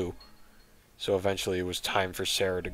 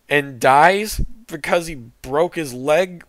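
higher, louder incredulous recap; exasperated rant; 7 semitones higher, 8 dB louder than usual. Real, not acted. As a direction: rant in disbelief. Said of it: "reader recaps in disbelief".

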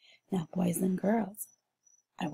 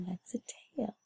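whispered; 12 dB quieter, much faster than usual. Shown as a demonstration. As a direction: whisper it softly. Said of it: "unvoiced whisper".

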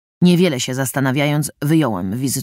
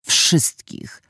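unvoiced, hissed-out final word; icy whispered "Wszystkich"; cold emphasis. A narrator's reading. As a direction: drop to a cold whispered point.

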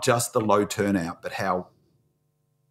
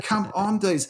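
exasperated; 10 semitones higher than usual. Retold or imitated. Retells own exasperation at crowd.

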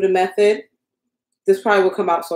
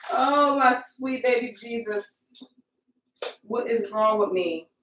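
slow, softer lament to herself; soft dismay; 2 semitones higher, 7 dB quieter, slower than usual. Real, not acted. Crafter scolding herself over ruined colours, slow soft lament. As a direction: groan it out under your breath.

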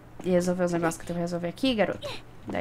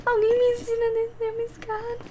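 mock plea; 12 semitones higher than usual. Can't get down the stairs.

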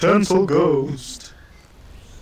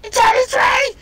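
high panicked scream; 8 dB louder than usual. Real, not acted. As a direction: scream in terror. Ghost coming, panic.

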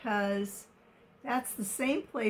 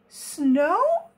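louder, higher, questioning cry; amazed shout; 7 semitones higher, 8 dB louder than usual. A narrator's reading. Elephants seeing snow for the first time, an amazed shout. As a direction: call it out high in astonishment. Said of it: "they shouted".

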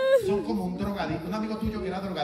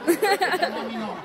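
mock indignant no; 11 semitones higher, 5 dB louder, much slower than usual. Mock protest.

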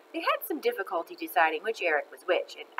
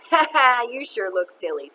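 mocking ha ha, loud at the start; 4 semitones higher, 6 dB louder than usual. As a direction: tease a classmate. Classmate mocks Stephen's costume.

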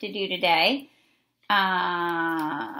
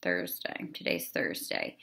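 breathy whisper, much lower and softer; whispered aside; 12 semitones lower, 7 dB quieter than usual. Real, not acted. Teacher whispering the day to herself before the lesson. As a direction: whisper it to yourself like checking the calendar.

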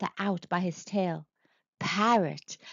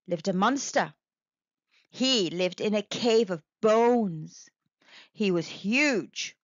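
plain reading voice

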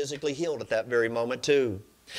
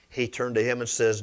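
ordinary talk, nothing marked

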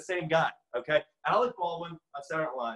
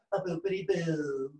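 slow, drawn-out mock Trump; Colbert as Trump. Winding down nonsense, a slow hush Trump impression. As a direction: trail out slowly in a put-on Trump voice. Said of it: "Colbert is more hush in his approach".

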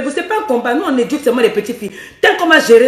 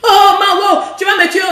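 high, loud hammed-up cry; mock pleasure cries; 7 semitones higher, 3 dB louder than usual. Shown as a demonstration. Coach mocking the wrong way to cry pleasure, loud. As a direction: overdo it like a crude moan. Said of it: "une titi boss ne parle pas comme ça".

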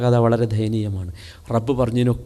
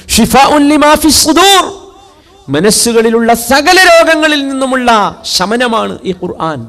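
high, loud and slow, declaiming the verse; preaching at full force; 11 semitones higher, 9 dB louder, slower than usual. Acted. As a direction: proclaim the verse to the hall. (ഉച്ചത്തിൽ പ്രസംഗം)

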